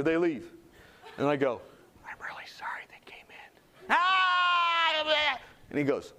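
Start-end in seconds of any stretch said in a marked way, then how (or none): 2.1-3.5 hushed apologetic whisper; voiceless, very soft apology. Retold or imitated. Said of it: "I would like him to whisper to me". 3.9-5.4 long high screamed "ah"; imitated patient's drawn-out wail; mock scream. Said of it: "He screams again".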